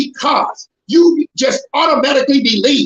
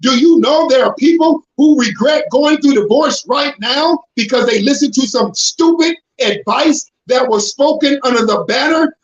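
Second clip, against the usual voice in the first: usual speaking voice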